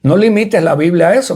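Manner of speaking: ordinary talk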